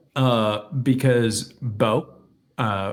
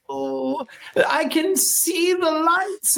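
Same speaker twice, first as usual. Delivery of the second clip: mock dying old man, slow and high; drawn-out feeble deathbed wail, hammed up; Bo Ryan; much slower than usual. Old coach near death answering his kids, feeble mock wail. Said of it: "he was close to death".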